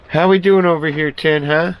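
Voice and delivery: high, cooing pet voice; talking to a pet. Real, not acted.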